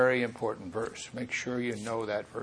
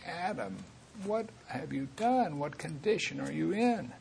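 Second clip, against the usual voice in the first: much softer, higher, near-tearful plea; God asking Adam gently, almost in tears; 3 semitones higher, 9 dB quieter than usual. Shown as a demonstration. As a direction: ask it grieving, close to tears. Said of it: "almost in tears saying".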